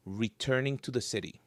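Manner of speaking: ordinary delivery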